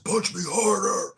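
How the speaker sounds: a high, mock macho taunt from the gym bully; tough gym guy. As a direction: dare him to hit harder, lightly hammed up. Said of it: "He's like".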